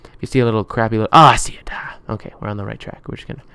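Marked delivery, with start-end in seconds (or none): 1.7-3.4 whispering. Real, not acted. Quiet whisper while sneaking.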